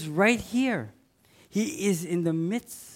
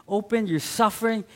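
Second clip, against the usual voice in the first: normal talking voice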